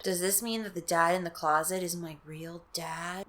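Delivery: soft wondering voice; inner thought. Acted. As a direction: ask it to herself. Girl wondering inwardly.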